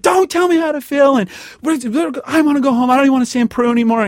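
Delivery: high, loud, hurt shouting back; angry outburst. Retold or imitated. Refusing his friend's comfort, shouting angrily. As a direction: snap back, rushing the last line, fast.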